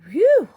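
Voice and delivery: high loud breathy whew; relieved whew. A narrator's reading. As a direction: blow out in relief. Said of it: "Cow sighed".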